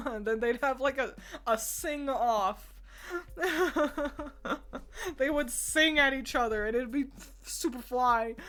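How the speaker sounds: usual speaking voice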